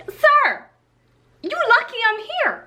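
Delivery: imitating what she wanted to snap back; sassy inner retort. Model answering the rude director in her head, high sassy retort. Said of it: "I was like".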